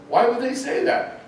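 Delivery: high, puzzled, innocent question; a colleague asking the boss, mock puzzled and high. Naive colleague voiced in story.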